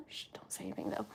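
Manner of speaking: quick hushed whisper. Real, not acted. To viewers, keeping it secret from her son, whispering fast. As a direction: shush them, it's a secret.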